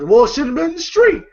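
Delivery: high, fast defiant retort. Retold or imitated. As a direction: talk back with attitude. Roach arguing back.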